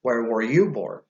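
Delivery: normal talking voice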